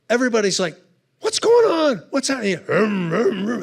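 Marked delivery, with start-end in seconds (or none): 1.2-2.6 much higher, alarmed cries; startled panic. Retold or imitated. Audience panics in smoke. 2.7-3.6 rumbling engine noise in the voice; motor rumble imitation.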